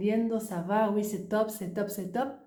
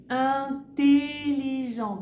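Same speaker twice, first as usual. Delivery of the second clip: slow demo word; 4 semitones higher, 3 dB louder, much slower than usual. Shown as a demonstration. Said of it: "pero ¿qué pasa?".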